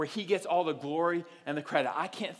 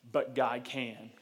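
slow emphatic line; 5 semitones lower, slower than usual. Shown as a demonstration. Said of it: "repeated key line, slowed".

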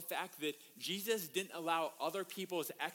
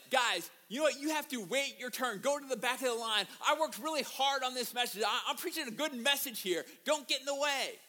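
loud, high, mock-annoyed scolding; hammed-up exasperated rant; indignant preacher; 6 semitones higher, 5 dB louder than usual. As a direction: tell off queue-jumpers, comically put out. Acts annoyed preacher scolding intruders.

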